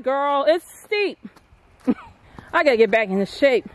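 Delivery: ordinary delivery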